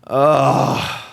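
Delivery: drawn-out pained groan; frustrated groan. Real, not acted. Streamer groaning over the lost comeback, pained. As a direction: groan in defeat.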